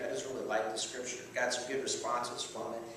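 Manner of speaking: normal talking voice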